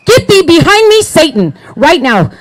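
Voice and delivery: high, loud deliverance-style rebuke; rebuking shout. Retold or imitated. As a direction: cast Satan out at full voice. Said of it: "I literally said it like that".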